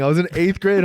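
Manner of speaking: normal talking voice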